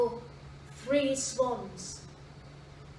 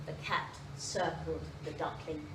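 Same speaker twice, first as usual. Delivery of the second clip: ordinary delivery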